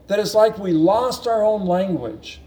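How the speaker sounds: usual speaking voice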